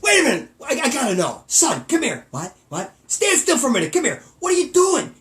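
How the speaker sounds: high, loud, exasperated dad yell; hammed-up angry dad yell. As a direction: bark at the son through the door. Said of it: "My dad was like Vic DiMatteo".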